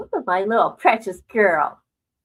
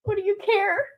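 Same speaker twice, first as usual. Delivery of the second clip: laughing tease; 6 semitones higher, 5 dB louder than usual. Retold or imitated. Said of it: "I go".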